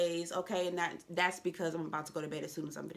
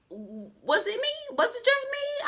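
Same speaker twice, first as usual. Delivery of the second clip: high squeaky mock question; 6 dB louder, slower than usual. Real, not acted. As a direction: pitch leaps way up, slow. Comic aside.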